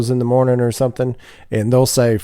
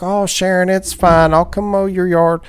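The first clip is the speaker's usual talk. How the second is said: high, sing-song Midwestern nice-guy voice; exaggerated Midwestern accent, sweet and neighborly; 9 semitones higher than usual. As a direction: play the Midwestern comedian's friendly neighbor. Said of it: "He's like".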